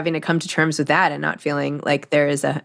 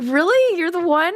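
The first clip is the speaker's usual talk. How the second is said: much higher disbelieving squeal; incredulous; 12 semitones higher than usual. Retold or imitated. Host retelling her stunned reaction to the guest, high squeal. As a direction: play your own stunned reaction, way up high. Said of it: "I'm like".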